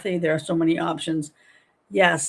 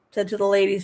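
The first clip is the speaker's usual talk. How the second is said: ordinary talk, nothing marked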